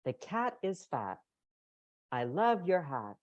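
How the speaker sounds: example phrase intonation. Shown as a demonstration. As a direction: read it with a big rising melody. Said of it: "So good examples here".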